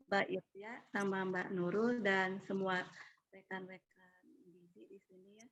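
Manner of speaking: faint, far from the mic; barely audible. Real, not acted. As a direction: voice too small to hear. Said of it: "suaranya agak terlalu kecil".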